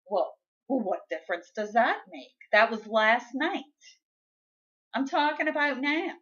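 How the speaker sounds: lightly mocked indignant husband, pitch climbing; indignant retort. Husband dismissing her point about last night, mock indignant.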